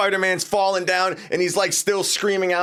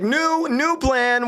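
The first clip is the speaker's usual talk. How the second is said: slow, higher "New, new plan"; slow announcement; 7 semitones higher, much slower than usual. Retold or imitated. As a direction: drag out "New, new plan" deliberately. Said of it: "Penny's like".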